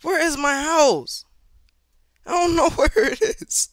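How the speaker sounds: high, loud wail of a lost player; panicked. Real, not acted. Gamer can't find his house in the game, loudly panicked. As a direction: cry out in rising desperation.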